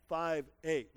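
ordinary talk

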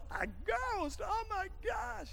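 high, startled mock cry of fright. Preacher playing a spooked rower in the boat, mock fright. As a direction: gasp it out like you just saw a ghost. Preacher acts disciples seeing ghost.

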